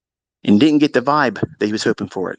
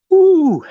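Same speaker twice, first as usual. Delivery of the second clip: loud, high drawn-out "ooh"; a high, admiring whoop of an ooh; 7 dB louder than usual. Acted. Storyteller reacting to the orange Lamborghini, admiring ooh.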